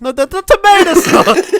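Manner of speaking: high, loud, slow mock insistence; hammed-up exasperated shout; exasperated Paulie. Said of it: "He was".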